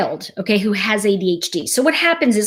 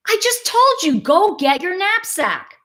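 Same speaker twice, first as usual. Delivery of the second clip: impatient mom; 8 semitones higher than usual. Retold or imitated. Mom impatient with distracted child.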